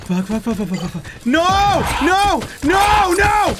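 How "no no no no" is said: high-pitched shouts of frustration; anguished yelling. Real, not acted. Player losing near the end, anguished yelling. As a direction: yell each word in despair.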